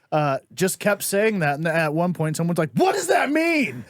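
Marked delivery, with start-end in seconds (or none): 2.7-3.8 high-pitched, drawn-out mock yell; exasperated shout. Coworker sick of the catchphrase, exasperated shout. Baffled coworker exclaims, retold.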